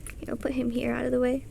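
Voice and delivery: fast whispered aside; whispering. Real, not acted. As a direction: mutter it quickly under breath. Quick aside while moving toy.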